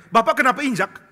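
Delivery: sudden high, loud startled question; kaget. Retold or imitated. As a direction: cry out in alarm at the stamping. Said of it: "Dia kaget". (seruan kaget, tinggi dan keras)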